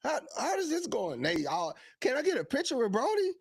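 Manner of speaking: high-pitched imitation of curious fans; eager fans. Retold fans asking eager questions.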